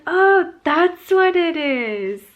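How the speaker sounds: high, loud, drawn-out "aha"; sudden realization. Retold or imitated. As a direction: exclaim it slowly as the penny drops. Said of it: "now I'm like".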